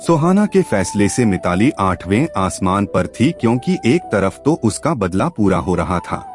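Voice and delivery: ordinary delivery, nothing marked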